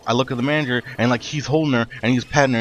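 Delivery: ordinary delivery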